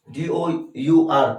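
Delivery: ordinary talk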